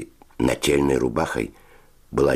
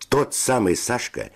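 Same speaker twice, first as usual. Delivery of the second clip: usual speaking voice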